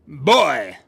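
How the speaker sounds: loud call across a crowd. Acted. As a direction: call the boy over, loud. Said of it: "Sir Valentino called".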